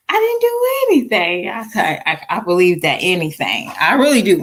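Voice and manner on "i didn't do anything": put-on much higher, whiny little-girl voice; imitating her daughter. Mom imitates her daughter denying everything in a high whine. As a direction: play the daughter protesting her innocence. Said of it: "So it was my daughter".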